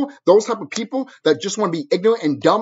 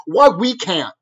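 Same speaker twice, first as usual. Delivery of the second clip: ordinary talk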